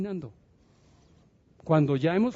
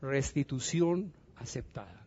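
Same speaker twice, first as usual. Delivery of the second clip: slow repeat; 3 semitones lower, 7 dB quieter than usual. Shown as a demonstration. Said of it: "Escríbalo así".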